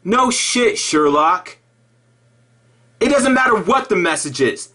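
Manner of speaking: normal talking voice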